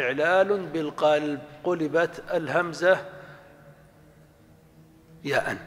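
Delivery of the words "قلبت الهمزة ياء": usual speaking voice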